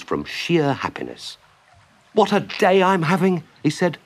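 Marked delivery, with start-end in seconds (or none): 2.1-3.4 high-pitched happy exclamation; overjoyed. Acted. Mole wriggling with happiness, overjoyed. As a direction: burst out with delight.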